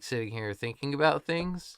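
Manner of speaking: ordinary talk, nothing marked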